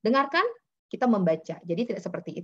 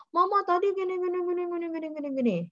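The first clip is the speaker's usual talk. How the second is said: put-on high, rushing child's chatter; lightly voiced excited child; imitating an eager child; 9 semitones higher, faster than usual. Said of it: "Kadang-kadang anak itu".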